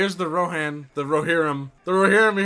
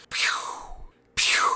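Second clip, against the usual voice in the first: whispering; 3 dB quieter than usual. Real, not acted. Imitates laser sound effect.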